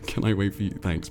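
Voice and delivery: ordinary delivery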